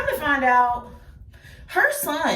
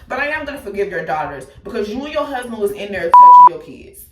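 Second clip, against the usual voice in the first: much louder, forceful condemnation; furious; 2 semitones lower, 10 dB louder than usual. Real, not acted. Accusing Michelle and her husband, furious loud condemnation. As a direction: hammer the accusation home loudly.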